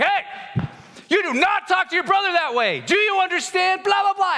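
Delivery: high, loud hammed-up dad yelling; dad bursting in to scold son, mock shouting; mock angry shout. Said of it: "I burst into the door".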